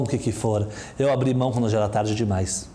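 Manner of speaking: usual speaking voice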